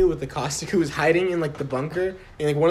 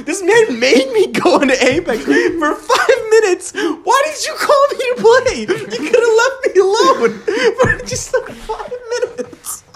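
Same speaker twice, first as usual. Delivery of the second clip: much higher, louder, laughing exasperation; laughing rant; 4 dB louder than usual. Real, not acted. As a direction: rant while cracking up.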